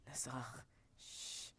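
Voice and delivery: ordinary talk, nothing marked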